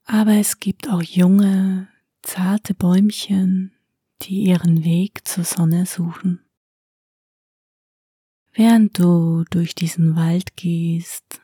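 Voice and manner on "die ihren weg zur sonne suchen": ordinary delivery, nothing marked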